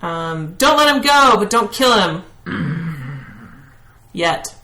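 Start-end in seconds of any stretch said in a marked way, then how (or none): 0.5-2.2 raised, higher call to an ally; yell. Acted. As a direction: yell an order across to your companion, easing off after. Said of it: "I'm gonna yell to Kapesk".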